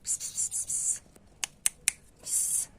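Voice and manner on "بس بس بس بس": pss pss calling. Real, not acted. Calling the cat softly.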